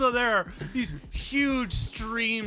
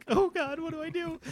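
higher, flustered little voice; nervous teen panic; 9 semitones higher than usual. Acted. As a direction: fret like a shy teenager left alone at the dance. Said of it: "clutching her own hands up to her chest".

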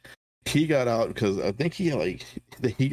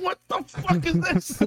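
high, slow, appalled outburst; high startled disgust; shocked disgust; slower than usual. Retold or imitated. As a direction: recoil at the taste. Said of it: "He said".

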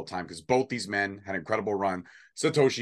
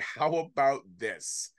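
ordinary talk, nothing marked

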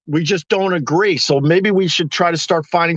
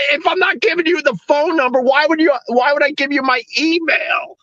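exasperated; 9 semitones higher than usual. Retold or imitated. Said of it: "I'm like".

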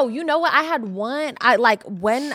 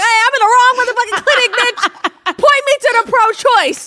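much higher, louder outraged shout; indignant yell; 13 semitones higher, 10 dB louder than usual. Retold or imitated. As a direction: shout like you just caught the trick. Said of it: "I'm like".

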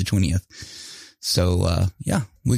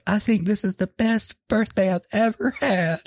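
high falsetto impression of a thrilled mom; mom's delighted gushing; 11 semitones higher than usual. Imitating mom thanking the sons for the surprise, delighted gushing. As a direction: gush in a squeaky mock-mom voice. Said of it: "she was like".

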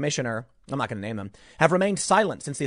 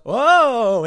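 higher, louder mock cry of pain; fake hurt yelp; 7 semitones higher, 8 dB louder than usual. Frasier's brother faking a fall, hammed-up yelp. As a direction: ham up a fake injury. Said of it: "Frasier's brother goes".

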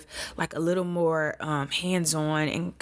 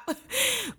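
teary laugh. Real, not acted. Laughing at her own tears.

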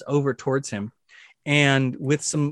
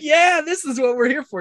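high-pitched hyped-up spectator; excited cheering; 11 semitones higher than usual. Acted. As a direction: egg on the fight in a high voice. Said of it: "you can see Alistair's, like, whipping his hand around".

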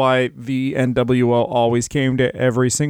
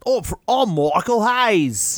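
higher, slow, hammed-up Australian accent; Michael Hayes in a bad Australian accent; 6 semitones higher, slower than usual. Co-host joining the bit, bad Australian accent. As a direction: drag it out in a cartoonish Australian accent. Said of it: "The accents are usually bad".

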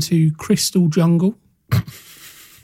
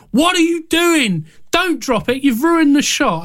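high-pitched mock director rant; James Cameron shouting; 9 semitones higher than usual. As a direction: play an angry director, pitched up. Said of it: "James Cameron's like".

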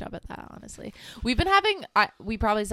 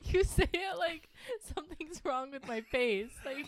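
laughing; 6 semitones higher than usual. Real, not acted. Laughing fit mid sentence.

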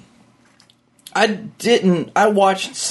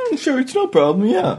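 high mock voice; 12 semitones higher than usual. Voices Chuck Taylor agreeing.

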